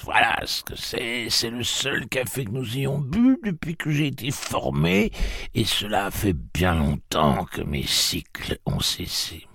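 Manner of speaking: put-on an old woman telling a bitter memory; old aunt. A narrator's reading. Aunt Talitha tells the travellers how Mercy was blinded.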